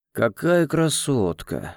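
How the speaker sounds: медленно, с сальной ленцой; тянет слова, кадрит девушку; нахальный флирт. Acted. Гвардеец заигрывает с официанткой. (развязный гвардеец)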